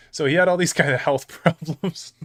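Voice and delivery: real laugh breaking into the words. Real, not acted. Streamer laughing at coworker's health story, laughing. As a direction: crack up while trying to tell it. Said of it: "Now I'm laughing about it".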